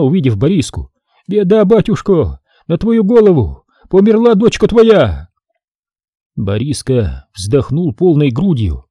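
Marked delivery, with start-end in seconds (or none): 1.3-5.3 keen the bad news; lamenting. A narrator's reading. Old woman wails to the priest that his daughter died. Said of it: "запричитала она".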